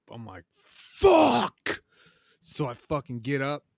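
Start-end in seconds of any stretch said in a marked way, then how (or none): 0.6-1.8 loud, high, drawn-out curse; exasperated loud cry; dismayed groan. Retold or imitated. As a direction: groan it out like you were just woken for court. Groaning at 3am court call.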